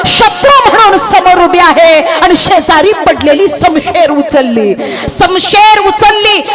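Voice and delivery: high, driving storyteller's voice; building dramatic narration. A narrator's reading. Storyteller tells how Jijau lifts the sword, high dramatic intensity.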